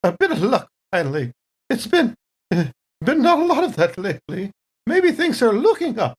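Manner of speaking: a weary crew member finally catching a break; relieved sigh of thanks. Acted. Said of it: "she's like".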